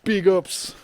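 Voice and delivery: high, loud mock patois shout-out; [name]. Imitating an actor's son in a viral video, loud mock patois. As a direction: shout it in an exaggerated Caribbean accent. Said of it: "speaking patois".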